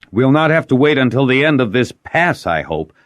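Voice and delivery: high, loud and petulant; peevish. A narrator's reading. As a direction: complain like a sulky lord. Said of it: "Sanjel said peevishly".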